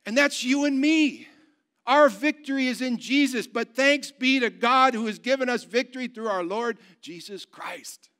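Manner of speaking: high, loud, rousing sermon delivery; forceful preaching. Real, not acted. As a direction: proclaim victory to the congregation.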